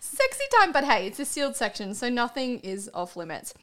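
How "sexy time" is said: mocking singsong. Real, not acted. Mocking the phrase again.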